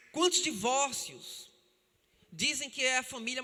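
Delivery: fervent sermon delivery; preaching. Acted. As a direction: drive the warning home to the church. Preacher exhorting the congregation.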